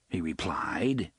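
plain reading voice

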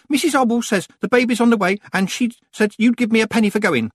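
put-on high, loud eager boy; small boy voice. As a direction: blurt it out breathlessly. Small boy blurts message.

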